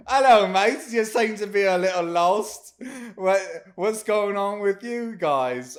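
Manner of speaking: high, loud British greeting; hammed-up British accent, up high; British clown voice. Clown greets lost adventurers in a loud British accent. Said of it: "they sound British".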